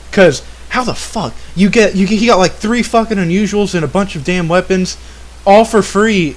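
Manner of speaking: real indignant incredulity; exasperated disbelief. Real, not acted. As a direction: demand to know how that's possible. Rants in disbelief at free items.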